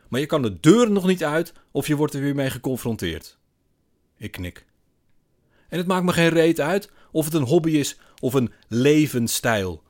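loud, high-pitched grievance; indignant rant. A narrator's reading. Man venting to narrator at the bar, indignant. Man rants about being confronted.

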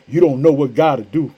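normal talking voice

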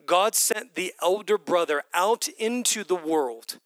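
ordinary talk

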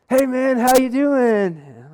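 high, loud, drawn-out mock hello; hearty phone greeting. Friend phoning out of the blue, overly hearty. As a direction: greet an old pal with exaggerated gusto.

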